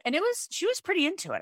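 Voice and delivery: ordinary talk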